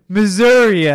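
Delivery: Missouri said loud as "Missoura"; imitating Trump's "Missouri"; Trump saying Missouri. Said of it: "instead of Missouri".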